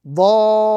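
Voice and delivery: chanted tajweed "Dhalina", long then joined; Quranic recitation. Shown as a demonstration. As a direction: recite "Dhalina" firmly with a held madd. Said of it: "we join with emphasis".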